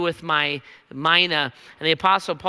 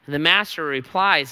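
ordinary delivery

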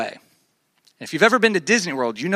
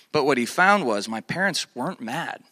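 ordinary delivery

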